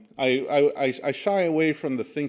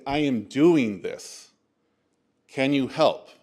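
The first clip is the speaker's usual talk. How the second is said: louder, slow, emphatic declaration; firm commitment; 6 dB louder, much slower than usual. Acted. Speaker models asking people for help, firm and committed. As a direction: state it firmly, slowly, with weight. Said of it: "You have to go in and say".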